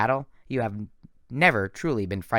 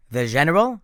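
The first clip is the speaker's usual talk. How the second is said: put-on French accent; 4 semitones higher, 7 dB louder than usual. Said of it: "I'd said it with a French accent".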